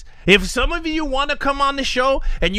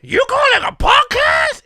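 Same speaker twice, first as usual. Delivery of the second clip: much higher shouted question; background yelling; 3 dB louder than usual. Retold or imitated. Host imitating the shouting background voice. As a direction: yell it from the background. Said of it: "I heard someone going".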